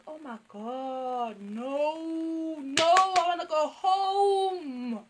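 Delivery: hammed-up dread, rising to a wail; panicked pleading. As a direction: whine it out, stretching the words. Scared guest wanting to leave.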